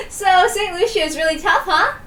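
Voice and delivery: a touch of laughing tease; teasing through laughter. Acted.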